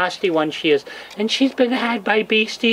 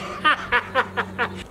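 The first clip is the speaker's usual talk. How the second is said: soft chuckle; 3 semitones higher, 4 dB quieter than usual. Real, not acted. Laughing at own joke.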